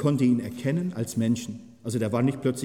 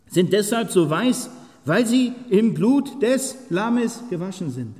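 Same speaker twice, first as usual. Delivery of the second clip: raised, louder solemn proclamation; solemn Bible reading, louder and higher; scripture reading; 7 semitones higher, 5 dB louder than usual. Acted. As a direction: proclaim the verses with full force. Reads Revelation aloud.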